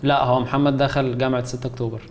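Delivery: normal talking voice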